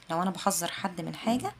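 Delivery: usual speaking voice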